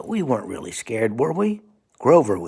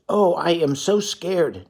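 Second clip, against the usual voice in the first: higher, slow, mock-frightened puppet voice; scared Grover; 7 semitones higher, slower than usual. Grover admitting fear, slow and high. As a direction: whimper it slowly, hammed-up fright. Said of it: "Grover says".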